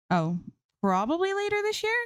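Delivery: offhanded. A narrator's reading. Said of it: "Zoe says offhandedly".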